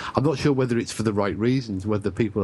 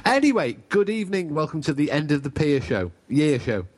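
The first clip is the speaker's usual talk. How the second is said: mock Cockney accent, higher pitched; stagey Cockney accent like a showman opening a seaside show; 4 semitones higher than usual.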